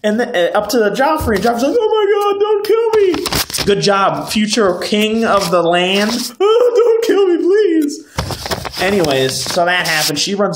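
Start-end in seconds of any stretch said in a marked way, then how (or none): 1.8-3.6 very high, drawn-out falsetto wail; mock terrified pleading. Recapper mocking the cowardly prince begging, falsetto panic. 6.4-8.8 very high falsetto begging; mock whimpering plea. Recapper mocking the future king begging, falsetto whimper.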